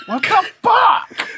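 high, slow shocked yell; startled shout. Real, not acted. Friend touched with something cold, yelps in shock.

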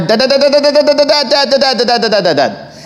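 put-on high, machine-gun child's nagging; imitated kid firing off Dads; rapid-fire Dad calls. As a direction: repeat it nonstop like an insistent kid, falling at the end. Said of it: "a rapid fire".

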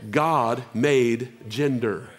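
God made gender, drawn out word by word, very slow; slow, deliberate declaration. Shown as a demonstration. Preacher to congregation, restating slowly for weight. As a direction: let each word land slowly. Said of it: "this is neutral".

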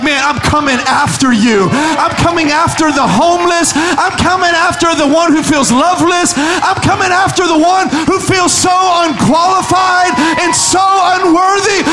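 high, loud, building declaration; preaching at full force. Acted. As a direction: preach God's pursuit with rising fire. God's voice, preached climax.

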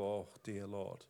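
slow, very soft sigh of dismay; mock reluctant sigh; reluctant groan. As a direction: groan as God asks too much. Mock reluctant reply to God.

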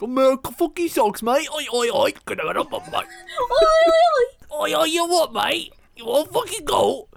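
high, hammed-up British yob; exaggerated British heckling, squeaking high on the ois; mocking British accent. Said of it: "he was like".

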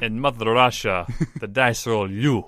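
put-on hammy Mother Russia accent; Russian accent. Player echoing a co-host's dice joke, Russian accent. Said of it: "try to do a Russian accent".

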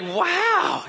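high, mock-amazed girl; impressed. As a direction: imitate her being wowed. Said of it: "She's like".

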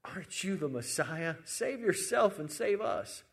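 taunting, pitch climbing on the demand; hurled insult. Retold or imitated. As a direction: jeer it, rising high and slowing at the end. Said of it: "he's still hurling insults at Jesus".

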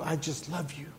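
soft, hushed return; quiet and tender. Acted. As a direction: drop back to a gentle hush. God's words falling back.

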